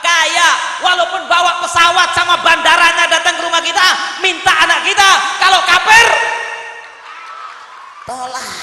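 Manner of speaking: loud, emphatic sermon delivery; fiery preaching. Acted. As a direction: preach it forcefully with lifted voice.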